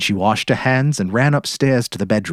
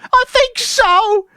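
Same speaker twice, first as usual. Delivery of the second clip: much higher, panicked shriek; frantic cry; 4 dB louder than usual. Acted. Mary crying to the police on the phone, frantic. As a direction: scream for help down the phone.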